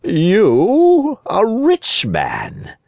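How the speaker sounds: high swoop dropping into a slow drawl; sly fox, mock amazement; incredulous fox. A narrator's reading. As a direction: scoff in drawn-out disbelief. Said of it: "said the fox".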